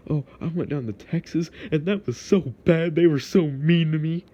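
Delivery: a lightly put-on whiny voice; mocking complainer. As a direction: mimic a visitor griping about Texas. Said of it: "A lot of people say".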